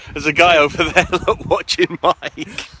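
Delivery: laughing. Real, not acted. Laughing at a man watching Mike.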